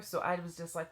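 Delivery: ordinary talk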